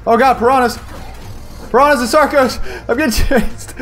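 high, frantic cries while being chased; panicked yelling. Real, not acted. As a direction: yell for help, fleeing in panic. Chased by piranhas in game.

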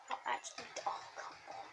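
hushed, voiceless grumble; whispered aside. Real, not acted. Gamer grumbles to himself at the game, whispered aside. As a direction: whisper it to yourself in frustration.

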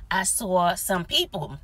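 ordinary talk

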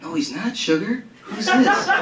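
high, flirty woman's voice with a laugh; sultry woman. Acted. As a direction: purr it like a seductive woman. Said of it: "answered in the voice of a woman".

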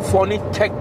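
usual speaking voice

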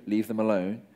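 usual speaking voice

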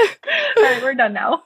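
host laughing as she ends the chat. Real, not acted. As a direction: wrap up while laughing. Laughing wrap-up of podcast.

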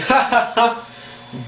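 brief, high ha-ha chuckle; demonstrated laugh. Shown as a demonstration. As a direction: act out a short laugh mid-sentence. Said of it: "I am laughing".

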